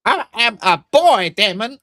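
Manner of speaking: high, slowed, put-on comic voice; Katt Williams character. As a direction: insist on it in a squeaky character voice. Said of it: "And he goes".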